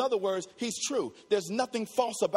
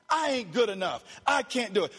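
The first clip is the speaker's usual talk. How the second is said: ordinary talk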